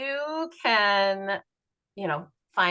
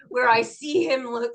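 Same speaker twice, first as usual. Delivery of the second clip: ordinary talk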